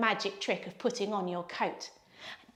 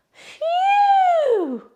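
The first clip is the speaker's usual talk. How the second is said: much higher, louder relieved phew; big phew; 9 dB louder than usual. Acted. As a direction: blow out all the worry. Relief cat and dog friends.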